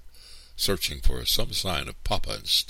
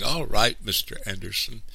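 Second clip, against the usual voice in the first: higher, trembling surrender; shaken, raised-pitch agreement; 7 semitones higher, 4 dB louder than usual. A narrator's reading. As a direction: give in with a frightened tremble. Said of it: "her voice trembling".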